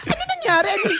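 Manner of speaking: high-pitched flustered question; comic alarm with laughter in it; retold panic. Wife retelling how she got rattled too, mock panic. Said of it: "nataranta din ako".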